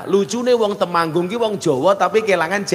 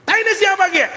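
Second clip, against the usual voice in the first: high shouted outrage, much higher pitch; mock shout; faster than usual. Ngapak man yelling at the Sundanese villager, mock outrage. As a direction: yell it out in disgust at the mess. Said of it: "langsung teriak".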